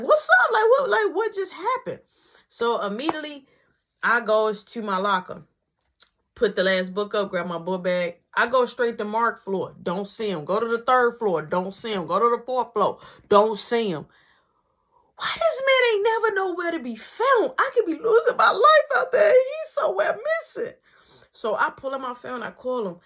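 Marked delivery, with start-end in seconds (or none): 0.0-2.0 high, loud, startled reaction; mock-alarmed, raised pitch; retold bewilderment. 15.2-20.7 high-pitched, loud panicked rant; hammed-up frantic complaint; acted frantic exasperation. Acted frantic complaint about missing friend.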